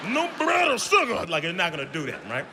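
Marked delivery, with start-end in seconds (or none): 0.1-1.2 mock prim kid, jumping high at the end; prissy kid. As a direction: play a fussy dieting kid. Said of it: "no kid is actually".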